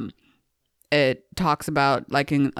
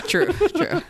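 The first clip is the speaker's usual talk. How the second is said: high mock agreement. Joking agreement after laughs.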